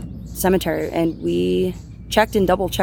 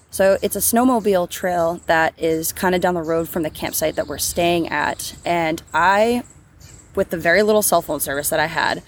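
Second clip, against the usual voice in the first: ordinary talk, nothing marked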